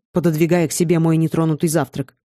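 ordinary delivery

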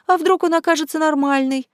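high-pitched teasing imitation of a friend; mocking mimicry. Ника mimics her timid friend's intonation, mockingly high. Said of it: "передразнила Ника интонацию Веры".

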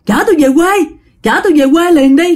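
high, pressing, half-panicked plea; scared mother begging her husband; frantic demand. Retold or imitated. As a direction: beg to be driven home now. Frightened mom demanding ride home.

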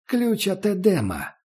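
high, slow secretive crone; old woman voice. Acted. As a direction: whisper the secret like an old hag. Barge woman's mysterious answer.

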